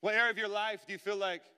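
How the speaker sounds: loud, high impassioned sermon; full-force preaching. Acted. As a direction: preach it out to the whole room.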